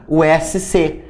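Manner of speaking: ordinary talk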